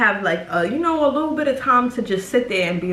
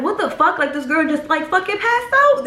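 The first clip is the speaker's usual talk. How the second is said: high, rising disbelief; imitating a stunned onlooker; hammed-up alarm, climbing in pitch; shocked; 5 semitones higher than usual. Grandmother reacts to the fainting.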